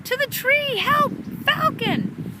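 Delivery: high, loud squirrel alarm; panicked screaming. A narrator's reading. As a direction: scream the warning in terror. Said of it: "the squirrels screaming".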